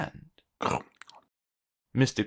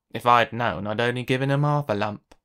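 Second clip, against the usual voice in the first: lower, grumbling complaint; grumbled; 4 semitones lower, 6 dB louder than usual. A narrator's reading. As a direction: grumble it like a stingy miser. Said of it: "grumbled Mister Mean".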